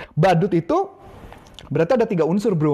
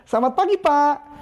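higher friendly selamat pagi; face-to-face greeting; 8 semitones higher than usual. Shown as a demonstration. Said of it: "Anda harus depan-depanan".

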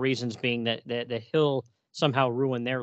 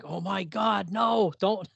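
alarmed plea; 5 semitones higher, slower than usual. Retold or imitated. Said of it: "I was like".

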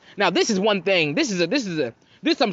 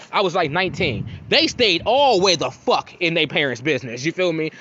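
ordinary talk, nothing marked

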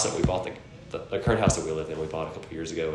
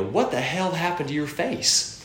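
shocked question; 9 semitones higher, slower than usual. Retold or imitated. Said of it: "Kayla go".

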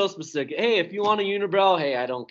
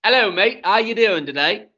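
higher, louder mock British accent; put-on British accent; 3 semitones higher, 5 dB louder than usual. Shown as a demonstration. Asked for their best accent, hammed-up British accent. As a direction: lay on a matey British accent. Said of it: "do your best accent".

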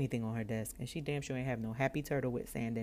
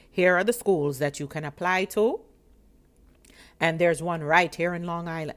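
loud, businesslike official announcement; serious clerk; 2 semitones higher, 10 dB louder than usual. Retold or imitated. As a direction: imitate a clerk firmly listing options. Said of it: "And she was so serious".

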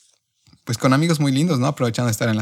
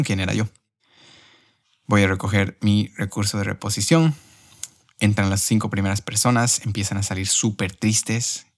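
usual speaking voice

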